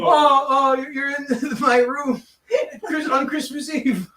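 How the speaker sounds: high, loud [name] voice, laughing; [name] startled. Acted. [name] surprised by her husband's ghost. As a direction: gasp it out as [name].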